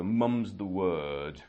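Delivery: slow, deliberate British English; drawn-out British pronunciation of the idiom; British accent. Shown as a demonstration. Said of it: "in British accent".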